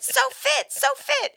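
very high, gushing repeats; exclaiming. Retold or imitated. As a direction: squeal it like an excited text. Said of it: "with an exclamation point".

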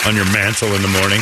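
usual speaking voice

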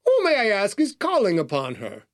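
high-pitched, formal butler query; haughty doorman screening a caller. A narrator's reading. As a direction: open high, then settle into polite formality. Formal butler questions visitor at door.

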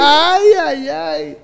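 high-pitched teasing wail; mock shock. Host reacts to Ramón's excuse, mockingly scandalised. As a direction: start high and tease with the exclamation. Teases Ramón's remark about men.

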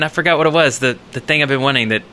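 ordinary delivery

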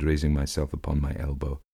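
ordinary delivery, nothing marked

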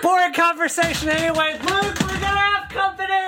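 high, loud hammed-up movie villain; villain quote. Bri plays a villain to the hosts, high and loud. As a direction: ham up a sinister movie line.

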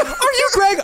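very high squeaky fan voice, laughing through "Are you Greg?"; better mock fan voice. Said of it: "I got a little bit better there".

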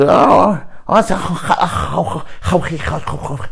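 high stuttering mock of a Korean colleague; playful impression of a foreign classmate; imitating Korean classmate. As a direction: stammer out the word in a high voice. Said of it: "فتجي وتقول".

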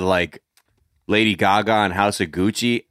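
ordinary delivery

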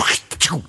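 short voiceless spurt noise; imitated dispenser noise. Ghost hunter retelling the second noise that made them run, imitated noise. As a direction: mimic the spray going off. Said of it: "when we heard the second".

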